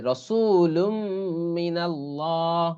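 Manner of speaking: slow Quran teacher's chant of রসুলুম মিনাল্লাহ; রসুলুম মিনাল্লাহ with long, sustained vowels; idgham recitation. Shown as a demonstration. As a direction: hold the m joining রসুলুম to মিনাল্লাহ. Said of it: "এই জন্য এরকম পড়া হচ্ছে".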